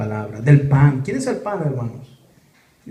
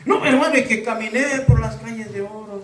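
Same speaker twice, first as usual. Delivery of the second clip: mocking boastful visionary; 6 semitones higher than usual. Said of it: "Como muchos".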